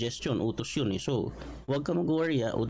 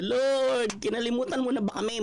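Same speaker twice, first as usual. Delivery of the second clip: anguished cry; 10 semitones higher than usual. Pretend. Imagined anguished public prayer.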